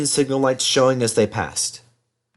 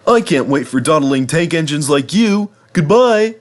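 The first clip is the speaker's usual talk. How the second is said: Henry boasting; 6 semitones higher, 4 dB louder than usual. Acted. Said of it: "asked Henry".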